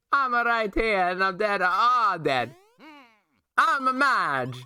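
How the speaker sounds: high, put-on Italian-ish Luigi voice. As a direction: squeak it out like a goofy Luigi. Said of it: "look for Luigi voice".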